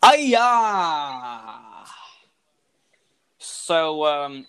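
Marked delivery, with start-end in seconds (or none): 0.0-1.8 loud pirate yell; pirate cry. Acted. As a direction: bellow it like a pirate. GM cries out as pirate.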